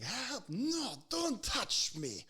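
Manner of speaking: high, strained mock cry; drawn-out wail of protest; imitated frantic resistance. Old patient fights the EMT over the harness, imitated high wail. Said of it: "fighting me on it".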